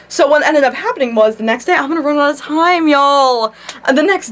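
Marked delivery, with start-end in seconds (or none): none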